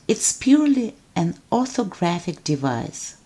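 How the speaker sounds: normal talking voice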